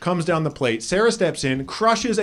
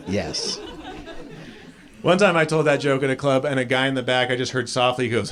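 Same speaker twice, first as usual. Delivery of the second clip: usual speaking voice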